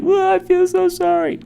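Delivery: high, wailing fake pity party; mock self-pity. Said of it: "pity party is more like".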